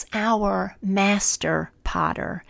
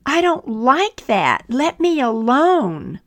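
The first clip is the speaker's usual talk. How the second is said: high, loud protest from the clay; yelling out; 7 semitones higher, 6 dB louder than usual. Acted. Clay yelling at its master to stop, loud and high. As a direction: cry out, begging him to stop. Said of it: "and I yelled out".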